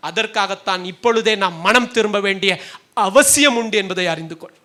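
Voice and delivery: ordinary talk, nothing marked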